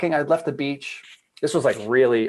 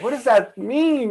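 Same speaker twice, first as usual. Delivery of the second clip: awed wonder; 10 semitones higher, 4 dB louder, slower than usual. Retold or imitated. Awed reply to inner voice.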